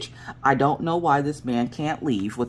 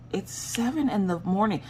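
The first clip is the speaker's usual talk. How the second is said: higher, softer groan of disbelief at the hour; exasperated complaint; 9 semitones higher, 4 dB quieter than usual. Retold or imitated. Woken by noise on the stairs, a higher, softer exasperated moan. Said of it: "And I'm like".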